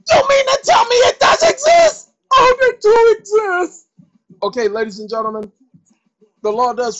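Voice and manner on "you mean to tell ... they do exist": high, loud put-on voice of disbelief; incredulous voice. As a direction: shout it like a shocked stranger. Voiced exchange with a doubter.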